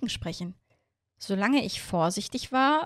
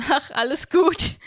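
high, loud, slow laughing reassurance; through laughter; 7 semitones higher, 7 dB louder, slower than usual. A narrator's reading. Miorin reassuring Hiro, high laughing dismissal. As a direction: wave it off while still laughing. Still laughing at Hiro's grin.

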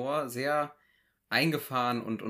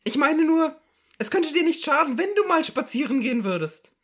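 higher, pestering housewife voice; nagging wife; 8 dB louder than usual. Acted. Wife nudging her resting husband, nagging. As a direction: fuss over him like a nagging wife. S0 plays wife in Loriot sketch.

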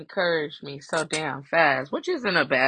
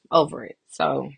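usual speaking voice

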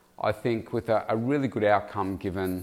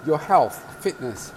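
ordinary delivery

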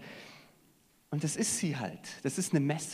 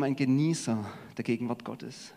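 normal talking voice

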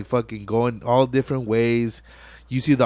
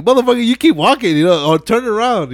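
tense inner plea; 9 semitones higher, 5 dB louder than usual. Retold or imitated.

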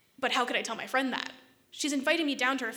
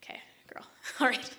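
whispered, breaking into a laugh; laughing whispered resignation; whispered okay; faster than usual. Retold or imitated. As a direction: sigh it out, half laughing at the list. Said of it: "I was like".

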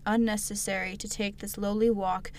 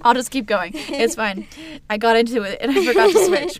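laughing; 3 semitones higher, 10 dB louder than usual. Real, not acted. Laughing at own reading mistake.

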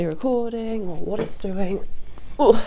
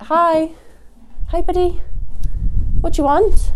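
much higher, louder, sing-song greeting to the horses; talking to horses; 11 semitones higher, 8 dB louder than usual. Real, not acted. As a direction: coo brightly at your animals like pets. Greeting her horses at the yard.

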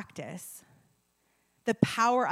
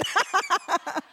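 high laugh; 10 semitones higher, 5 dB louder than usual. Real, not acted. Laughs at herself.

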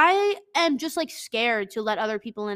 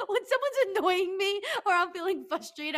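laughing; 8 semitones higher than usual. Real, not acted. Laughing while talking.

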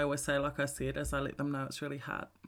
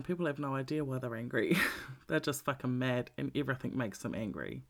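ordinary talk, nothing marked

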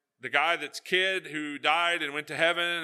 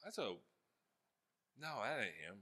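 softer, lower, deflated grumble; disappointed muttering; 5 semitones lower, 7 dB quieter than usual. Retold or imitated. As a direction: let the air out of it, unimpressed. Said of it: "And you're like".

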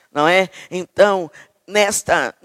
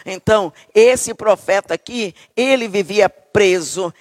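normal talking voice

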